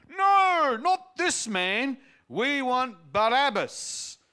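high, loud, slow shouting; crowd shouting back. A narrator's reading. Crowd shouting back at Pilate, loud and high. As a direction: bellow it at the governor like an angry mob. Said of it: "they shouted back".

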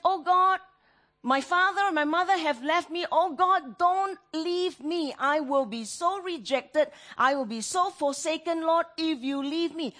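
high, loud begging prayer; loud desperate plea. Acted. Preacher voicing the psalmist afraid of being forsaken, loud desperate plea. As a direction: plead as if about to be abandoned.

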